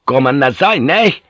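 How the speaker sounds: loud call, lifting high at the end. A narrator's reading. Drunk reeling off toward the house, calling out. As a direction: call back to them drunkenly. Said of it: "he called out again".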